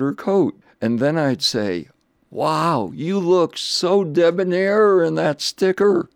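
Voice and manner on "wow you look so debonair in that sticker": higher-pitched, admiring gush; gushing compliment. Retold or imitated. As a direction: flatter them with lifted, playful enthusiasm. Flattering voters when handing out stickers.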